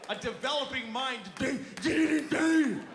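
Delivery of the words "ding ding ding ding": loud, high mocking ding-ding; sing-song it like a slot machine; mock game chimes. Mocking video games, a loud, high chime-like chant.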